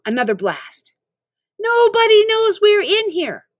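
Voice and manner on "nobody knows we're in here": high, loud frustrated cry; angry rant. Acted. Narrator ranting at Eric while blasting him. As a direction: vent the panic at full pitch.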